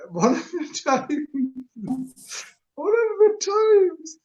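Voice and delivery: chuckling at himself, pitch rising high. Real, not acted. Man amused at his own story, chuckling. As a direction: say it slowly through a high chuckle. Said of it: "I'm chuckling at myself".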